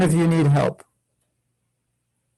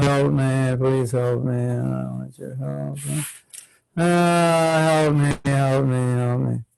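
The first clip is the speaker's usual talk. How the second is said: low, slow monotone drone; rote, listless begging that fades and swells; mindless droning plea; 5 semitones lower, slower than usual. Shown as a demonstration. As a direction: drone it out on one note, without meaning a word. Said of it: "I said it like this".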